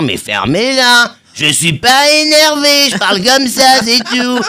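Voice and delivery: voix très aiguë, forte et lente; belle-mère gitane. Acted. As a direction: une belle-mère gitane qui crie sans être fâchée. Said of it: "j'ai une belle-mère aussi gitane". (belle-mère gitane qui hurle, aiguë et lente)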